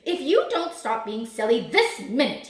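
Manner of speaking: loud, emphatic scolding; roaring. A narrator's reading. Big Bad Wolf to silly number five, roaring. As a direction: roar the warning. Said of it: "he roared".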